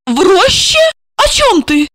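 high-pitched, bewildered question; astonished. Acted. Wife baffled by husband's answer, astonished. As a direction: echo him in baffled alarm. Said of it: "изумлённо посмотрела на мужа".

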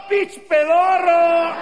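high, slow drawn-out yell; mocking shout. Friend taunting the returning guy with his nickname, shouting.

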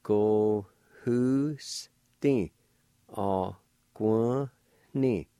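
"Ko hu sti a guan ni" drawn out very slowly; slow syllable-by-syllable "Ko hu sti a guan ni"; slow blended word. Shown as a demonstration. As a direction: stretch the whole word out. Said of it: "And together".

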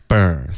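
BIRTH bursting from b into an open er; no rounding, straight to "er" in "birth". Shown as a demonstration. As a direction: model "birth" slowly, mouth open. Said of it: "嘴巴张开的儿".

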